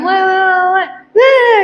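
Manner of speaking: high-pitched mock crowd babble; group chatter. Choir group gathered after singing, imitated high chatter.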